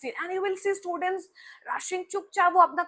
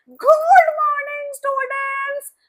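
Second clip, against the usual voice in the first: very high, loud, slow, drawn-out sing-song greeting; shouting teacher greeting; 12 semitones higher, 9 dB louder, much slower than usual. Retold or imitated. Teacher greeting the class with a loud, stretched-out shout. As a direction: belt it out like an overexcited teacher. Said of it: "बहुत सारे teachers होते हैं ना".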